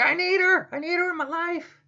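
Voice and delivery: a high, pleading, needy voice; whiny Anakin. Acted. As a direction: beg like a lovesick teenager.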